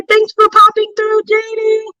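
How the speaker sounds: high singsong farewell; cheery thanks. Real, not acted. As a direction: send a friend off in a lifted, lilting voice. Thanks a departing viewer.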